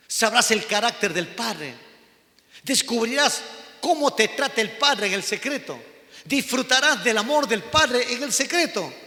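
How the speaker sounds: loud, rising preacher's cadence, pounding each promise; full-force preaching. Acted. Pastor urging the church about the Father's love, preaching at full force. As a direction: preach it out, building fervour.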